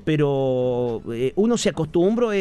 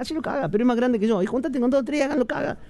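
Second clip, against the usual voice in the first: put-on kid and dad voices; 8 semitones higher than usual. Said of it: "Vos le decías".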